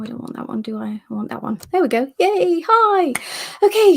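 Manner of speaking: ordinary talk, nothing marked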